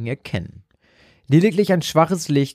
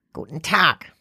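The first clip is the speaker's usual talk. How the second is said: croaking; 3 semitones higher than usual. A narrator's reading. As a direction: croak it out like an old shopkeeper. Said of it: "krächzte sie".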